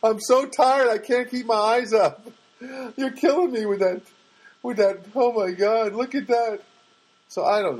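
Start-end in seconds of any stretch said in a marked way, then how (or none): 0.0-2.3 high, louder, giddy mock of a tired giggler; mock sleepy giggler. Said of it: "I'm not like". 3.0-6.6 mock giggly delight. Mocking the giggling girl.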